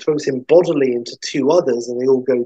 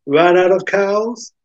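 dry quip; 7 semitones higher, 3 dB louder, slower than usual. Acted. Bond's quip back at the hick.

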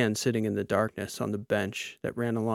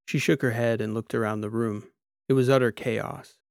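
plain reading voice

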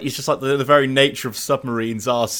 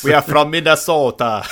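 mock Minnesota accent; 2 semitones higher, 3 dB louder than usual. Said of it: "explain their accents".

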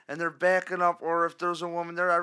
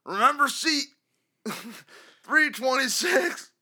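higher, louder hammed-up old fan, shouting; old diehard caller; 6 semitones higher, 6 dB louder than usual. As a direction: bellow it like a crusty season-ticket fan on the radio. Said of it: "whatever the hell this guy wants to say".

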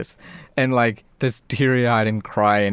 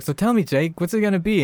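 higher-pitched challenge from a doll; coaxing killer doll; 7 semitones higher than usual. Retold or imitated. Chucky pressing Jake to choose, coaxing. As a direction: press him for an answer as Chucky.

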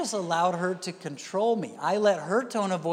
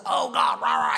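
loud, raspy, growled rant; mock yelling at God; 5 dB louder than usual. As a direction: imitate a believer roaring angrily in prayer. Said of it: "you keep yelling at him".

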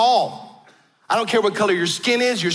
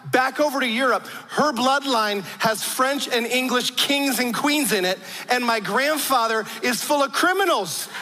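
ordinary talk

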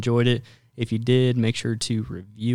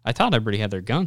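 normal talking voice

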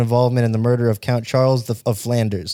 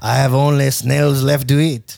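fake French accent. Continues fake French peasant.

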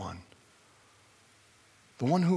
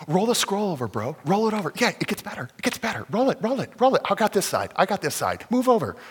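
higher pitched, eager push; grabbing the scroll in excitement; eager urging; 6 semitones higher, 4 dB louder than usual. Acted. Eunuch urging again, grabbing scroll.

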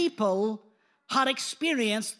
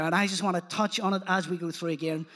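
usual speaking voice

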